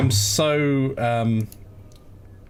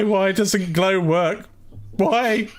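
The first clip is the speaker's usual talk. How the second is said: whiny complaint; 6 semitones higher, much slower than usual. Retold or imitated. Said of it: "reenacting the commit note".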